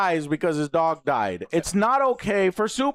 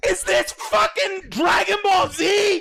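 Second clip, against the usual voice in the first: high, outraged shouting; real angry yelling; 10 semitones higher than usual. Real, not acted. Reviewer ranting about Superman's lore, angry shouting. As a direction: yell it in exasperated disbelief. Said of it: "Why are you fucking yelling".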